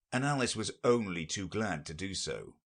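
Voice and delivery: ordinary delivery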